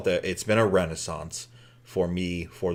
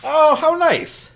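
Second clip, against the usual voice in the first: sarcastic; 5 dB louder, much slower than usual. Acted. Sarcastic reply to praise.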